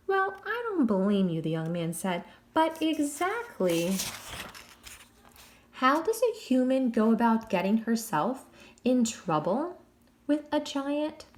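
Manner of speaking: wry English prisoner, stretching the word. Acted. Jack quizzing the girls about their grandmother, wry English accent.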